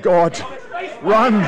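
loud, high desperate command; urgent shout. Acted. Klaatu shouting at Helen to run to Gort, loud and high. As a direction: push her away with the shout. Wounded man urges Helen.

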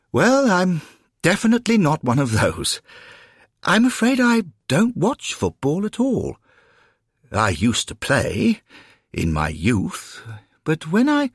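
high, hesitant, courteous gentleman; apologetic gentleman newcomer. Acted. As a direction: make polite excuses in a high voice. Newcomer explains himself.

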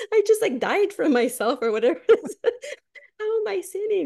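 laughing, high and incredulous; half-laughing protest at being called a sinner. Retold or imitated. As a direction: laugh it off in disbelief. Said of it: "I'm like, "Well, wait".

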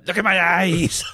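put-on loud, high German-accented shout; hammed-up German accent, yelled as an order; mock German accent. Imitating the girl demanding eye contact, shouted German accent. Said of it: "how do you do a German accent".

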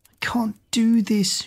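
slow, whispered half-sob; a touch of tearful despair; whispered, slowed lament near tears; sobbing. A narrator's reading. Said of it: "she sobbed".

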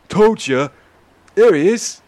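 high, loud I-told-you-so; excited holler pointing someone out; triumphant shout. Acted. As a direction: crow it to the crew, vindicated. Vindicated sailor crows to crew.

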